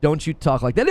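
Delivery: ordinary talk, nothing marked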